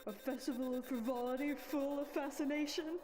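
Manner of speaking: hushed alliterative promo read; trailer voiceover. Acted. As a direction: tease the next episode. Closing trailer-style outro read.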